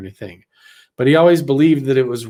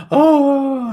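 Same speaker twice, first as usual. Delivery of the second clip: high-pitched mock wail; imitated crying; 12 semitones higher, 5 dB louder than usual. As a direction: wail it out, imitating a woman bursting into tears. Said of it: "immediately bursts into tears again".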